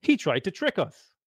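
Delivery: rally the crowd against him; shouting. Acted. Oldest friend turning the market crowd on him, shouting.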